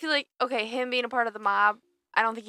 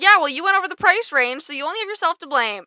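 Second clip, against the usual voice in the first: loud, raised-pitch indignant retort; mock scolding; 8 semitones higher, 7 dB louder than usual. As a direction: scold him as if he were right here. Acted scolding retort to the character.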